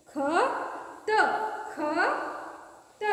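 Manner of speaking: ख and त split apart with long pauses, saying त not थ; teacher sounding out a word letter by letter; syllable by syllable. Shown as a demonstration. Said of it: "थ नहीं त".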